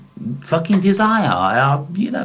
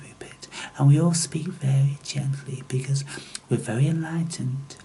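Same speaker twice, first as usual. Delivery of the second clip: hushed mock-gentle whisper, softer; mocking meditators; 6 dB quieter than usual. Mocking Rupert Spira's followers in a soft whisper. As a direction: sneer at serene disciples in a breathy hush. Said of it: "they're all kinda like".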